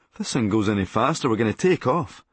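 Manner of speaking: ordinary talk, nothing marked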